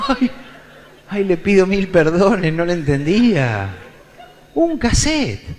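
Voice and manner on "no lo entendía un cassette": ordinary talk, nothing marked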